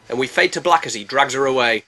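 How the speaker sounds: ordinary talk, nothing marked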